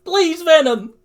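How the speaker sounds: very high, loud desperate shout; acted anguished outburst; yell. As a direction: scream at him, pleading. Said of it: "Izuku yells".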